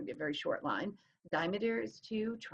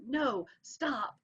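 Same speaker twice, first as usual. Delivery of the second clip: "no, stop", both hit hard and higher; spondaic double stress; 6 semitones higher than usual. Shown as a demonstration. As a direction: punch "no" and "stop" equally. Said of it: "Both of them get a really hard hit".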